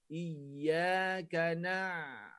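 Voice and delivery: iyaka na- held very slowly. Shown as a demonstration. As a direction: linger on iyaka na-. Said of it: "sebut dia cepat sangat". (iyaka na- perlahan)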